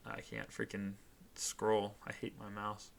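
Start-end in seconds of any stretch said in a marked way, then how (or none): none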